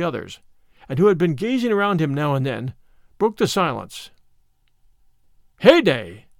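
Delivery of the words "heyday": louder, lower "Heyday!"; surprised exclamation. A narrator's reading. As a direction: call out on spotting something. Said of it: "quoth he".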